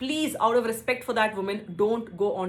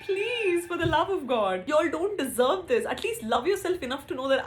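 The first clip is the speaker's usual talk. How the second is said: pleading; 9 semitones higher, 5 dB louder than usual. Real, not acted. Begging viewers to value themselves.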